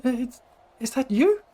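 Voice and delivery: high, halting, uncertain question; hesitant Tali. Acted. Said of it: "She looks at you, she's like".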